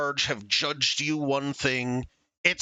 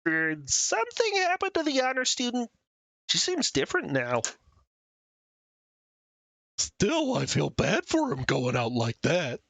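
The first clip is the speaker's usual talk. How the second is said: high, odd classmate voice; new character voice; 4 semitones higher than usual. Acted. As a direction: voice a gossiping student. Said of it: "I'm trying to find new voices".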